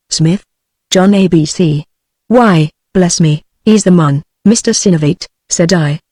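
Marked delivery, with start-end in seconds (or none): none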